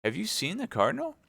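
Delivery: high, startled wife asking; alarmed question. Acted. Wife alarmed that her husband saw the cardinal, high startled question. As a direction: snap up in alarm at the news.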